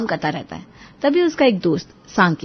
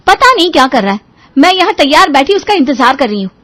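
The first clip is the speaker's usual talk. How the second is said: irritated wife, higher and louder; loud, raised-pitch annoyance; angry; 7 semitones higher, 10 dB louder than usual. Acted. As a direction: fume at being kept waiting. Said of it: "श्रुति गुस्से में".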